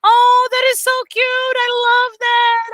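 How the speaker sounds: very high, loud, drawn-out gushing; gushing over cute students. Retold or imitated. Teacher gushing over her students' adorable mistakes, squealing. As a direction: squeal it like you're melting over something adorable. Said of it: "and I'm like".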